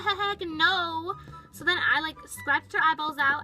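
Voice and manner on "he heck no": stuttered start into drawn-out high refusal; emphatic refusal. Retold or imitated. Girl refusing to be taken away, emphatic. Acted refusal on realizing the danger.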